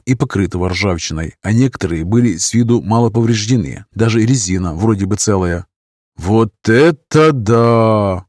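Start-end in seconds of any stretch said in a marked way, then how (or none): 6.2-8.2 slow, drawn-out amazement; amazed drawl. Acted. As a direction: stretch it out in wonder. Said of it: "протянул я изумлённо".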